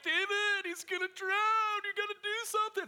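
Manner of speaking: high, loud panicky mother; panicked mom. Retold or imitated. Mother urging her son to save the sinking boy, high and panicked.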